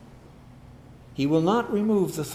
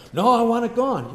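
a raised, whining refusal; mock protest; 6 semitones higher, 6 dB louder than usual. Believer begging God to take the trial, raised protest. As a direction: push back like a stubborn believer.

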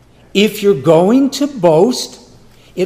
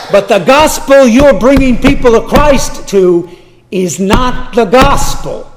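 higher and louder, pressing hard; rising rebuke; 7 semitones higher, 8 dB louder than usual. Acted. Paul confronting the church, rising and loud. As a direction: preach it as a building rebuke. Preacher voicing Paul's rebuke.

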